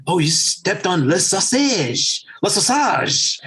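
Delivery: lightly put-on French accent, a bit slow; half-hearted French teacher innuendo in a French accent; a loose imitation of a French accent; mock French accent. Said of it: "I can't do the accent".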